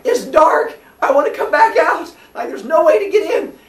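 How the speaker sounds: high loud frightened yelling; scared kid in the dark crawl space, high panicked yell. Retold or imitated.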